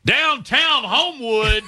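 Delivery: high, slow and gleeful; excited. Retold or imitated. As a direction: savor the memory with a grin. Recalling golf balls hit downtown.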